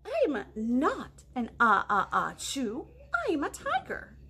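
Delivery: usual speaking voice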